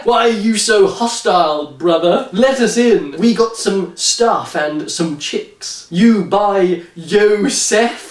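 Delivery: slow, laughing fake street accent. Podcaster mocking the novel's dialect dialogue, slow put-on accent. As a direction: read it in a deliberately bad accent, half laughing.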